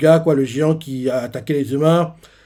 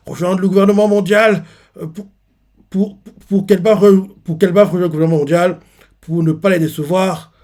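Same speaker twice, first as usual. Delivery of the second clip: tearful confession; 5 semitones higher, 6 dB louder than usual. Retold or imitated. Harald confesses with tears in his eyes, anguished. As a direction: speak through held-back tears. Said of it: "il a des larmes aux yeux".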